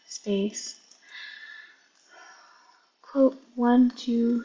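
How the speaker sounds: reading code aloud slowly, piece by piece; slow dictation. Real, not acted.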